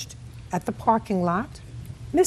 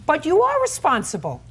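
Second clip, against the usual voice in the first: louder and higher, cutting him off; firm objection; 6 semitones higher, 4 dB louder than usual. Real, not acted. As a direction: slam the point home. Judge contradicting the defendant.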